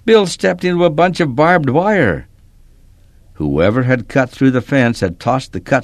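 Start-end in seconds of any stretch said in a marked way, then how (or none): 0.1-2.3 panicked. A narrator's reading. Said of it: "panic in my voice".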